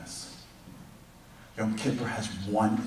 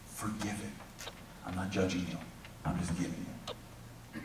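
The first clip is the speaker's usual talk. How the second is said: tender whisper; 6 semitones lower, 15 dB quieter than usual. Retold or imitated. God whispering gently to his people. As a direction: whisper it like a loving father.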